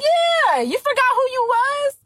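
high, sing-song teasing God; high playful God. Retold or imitated. God teasing her for forgetting herself, high and playful. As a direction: tease her like a fond parent.

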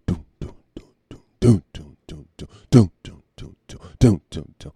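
dun dun dun sung as a suspense sting; mock drama sting. After the news joke, mock suspense sting. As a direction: hum a dramatic music cue.

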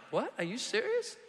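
high-pitched rising disbelief; incredulous. Acted. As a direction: squeak it, unable to believe your ears. Retelling disbelief at being kicked out.

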